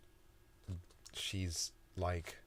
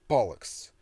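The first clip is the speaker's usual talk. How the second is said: loud, high outburst; angry curse; 4 semitones higher, 11 dB louder than usual. Acted. Toby kicking the wall, loud furious curse. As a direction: spit it out in frustration.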